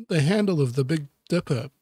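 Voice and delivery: normal talking voice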